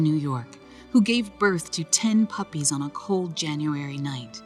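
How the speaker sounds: plain reading voice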